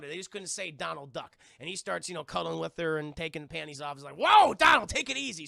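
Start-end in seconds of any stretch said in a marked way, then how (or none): 4.2-5.5 loud, high mock-alarmed outburst; playful yell of alarm; startled shout. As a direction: burst out loud to rein someone in. Alarmed character cutting in, acted.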